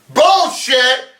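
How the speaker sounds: high, loud yell of disbelief; outraged shout. Real, not acted. Podcaster calls out the movie's claim, with a loud high yell. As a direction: bark it out in outrage.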